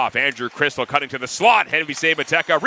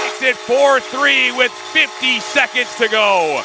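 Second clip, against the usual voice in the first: slow build to a higher, louder goal call; long goal yell; 9 semitones higher, 4 dB louder, much slower than usual. Real, not acted. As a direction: wind up and explode on the goal. Late goal call in hockey.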